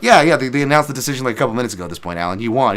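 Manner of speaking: excited reply. Retold or imitated. Coach telling his fighter he won, excited. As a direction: rush it out, grinning at the late realization. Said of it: "I'm like".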